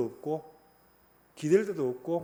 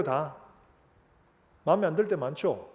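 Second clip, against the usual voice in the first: normal talking voice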